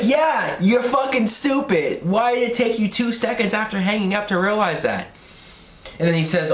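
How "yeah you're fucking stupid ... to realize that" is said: high, incredulous agreement; exasperated mockery. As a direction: throw it back at him, pitched way up. Mocks the character's late realization.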